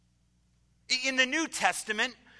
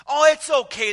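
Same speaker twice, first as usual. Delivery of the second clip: booming, much higher and louder hearty greeting; burly pastor; 6 semitones higher, 11 dB louder than usual. Retold or imitated. Burly pastor greeting the student at the door, booming and hearty. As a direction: boom it out like a jolly big pastor. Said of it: "the pastor says".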